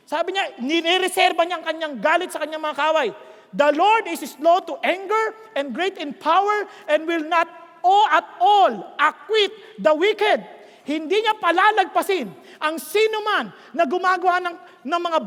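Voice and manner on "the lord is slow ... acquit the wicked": slow, weighty preacher's declamation; emphatic scripture reading. Acted. Preacher reads Nahum to the congregation, emphatic. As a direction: pound out each phrase slowly.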